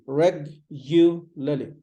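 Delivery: reg-u-lally in slow separate parts; "reg-u-lally" with pauses between syllables; syllable-by-syllable regularly. Shown as a demonstration. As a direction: say reg-u-lally as REG, yoo, luh-lee. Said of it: "So it's like reg-u-lally".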